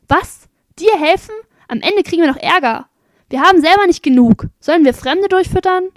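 high, loud, scornful refusals; indignant shouting. A narrator's reading. Townspeople turning away a begging stranger, indignant shouting. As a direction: reject the beggar with outraged questions. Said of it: "jedermann stieß sie fort und schrie".

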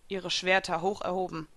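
ordinary delivery, nothing marked

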